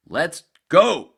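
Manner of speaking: high loud put-on voice; mock excited call; mock urging. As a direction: bark it out in a hammed-up voice. Retold quote, loud put-on.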